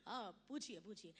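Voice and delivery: low, soft baritone star voice; Amitabh Bachchan impression. Amitabh greeting the comedian's mother, in a low soft baritone. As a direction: sink into a deep, hushed Bachchan baritone. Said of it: "बार बार थोड़ी मिलना अमिताभ बच्चन से".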